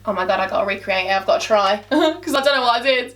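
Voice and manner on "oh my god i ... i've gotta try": low, quick mock worry; daunted second thought. Vlogger retelling her doubts about recreating a look, low mock dread. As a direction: drop low and hurry through it. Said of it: "And then I'm like".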